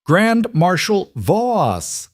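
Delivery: slow, high call across the field. Acted. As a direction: drag out the name to the army below. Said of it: "Eric's raised his voice".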